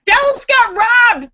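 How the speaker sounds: Dallas got robbed, high, loud and slow; indignant outcry. Real, not acted. Vlogger protesting the referee's call to viewers, loud and indignant. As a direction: belt it out in real protest.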